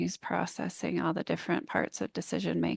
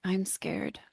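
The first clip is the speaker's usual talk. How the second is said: retold scared whisper. Friend in tears confessing his fear, whispered. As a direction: breathe it out like a frightened man in tears. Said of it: "And he's crying".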